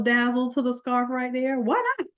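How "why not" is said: why not, squeaked way up high; tossed-off tease, pitched far up; playful high why not. Real, not acted. Teaching a design trick, she squeaks a playful high aside.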